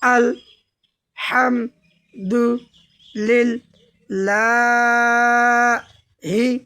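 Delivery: each word drawn out very slowly, one at a time; slow chanted Quran reading; broken slow recitation. Shown as a demonstration. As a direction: recite word by word with long pauses. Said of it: "ভাঙা ভাঙা উচ্চারণ করব".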